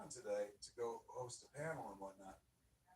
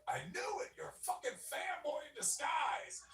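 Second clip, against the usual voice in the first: high, loud, gleeful gotcha shout; accusing shout; 5 dB louder than usual. Retold or imitated. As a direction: bust him loudly. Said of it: "Disney to be like".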